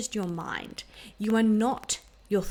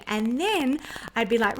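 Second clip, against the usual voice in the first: normal talking voice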